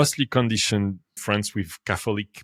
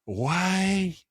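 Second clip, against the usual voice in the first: higher, rising astonishment; excited retold wonder; 7 semitones higher, slower than usual. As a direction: gush like a convert. Both amazed after the ceremony.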